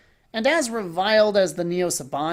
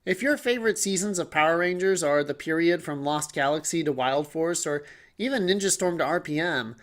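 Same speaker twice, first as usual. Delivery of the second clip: ordinary delivery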